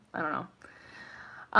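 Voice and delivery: soft, slow, almost voiceless mumble; mumbled shrug. Real, not acted. Reader backing off her joke, mumbling. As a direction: let it fade away under your breath.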